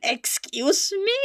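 slow, drawn-out "excuse me", rising at the end; exaggerated, playful affront; mock indignation. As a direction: stretch it out in mock disbelief. Mockingly echoes the read-out message.

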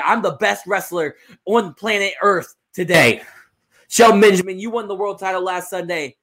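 lightly voiced confident champion; wrestling promo, pitched a little up and punched; wrestler promo. Champion calls out Shelton Benjamin in the ring, lightly voiced promo.

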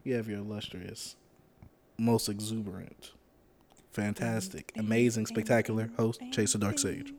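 slow, grand host intro; announcer voice. Acted. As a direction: roll out each title slowly. Hyped host self-introduction.

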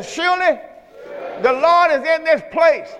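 high, ringing lead for the congregation to repeat; preached call-and-response. Shown as a demonstration. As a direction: sing it out for them to echo. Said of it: "put your hand on your chest and say".

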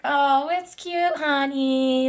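slow, high, drawn-out motherly coo; cooing mom. Retold or imitated. Mom seeing daughter's tattoo, exaggerated slow coo. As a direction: imitate a mom stretching out her approval.

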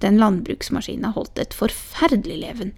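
ordinary delivery

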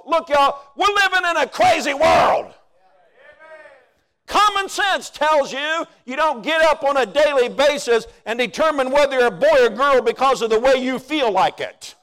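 high, loud shouting sermon; full-force preaching. Real, not acted.

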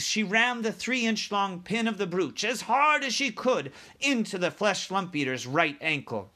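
plain reading voice

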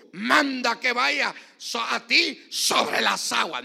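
high, shouted preacher's reading; full-force scripture reading. Acted. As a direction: roar the verses out to the whole church.

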